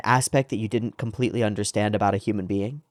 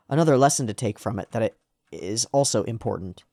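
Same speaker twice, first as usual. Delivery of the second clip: ordinary talk, nothing marked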